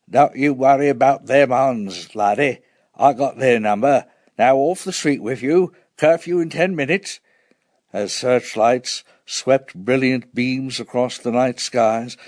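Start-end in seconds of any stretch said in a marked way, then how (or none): 0.1-7.2 voiced street warden, brisk orders. A narrator's reading. Warden answering a child before curfew, in character. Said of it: "Mister McTaffy cycled down our street".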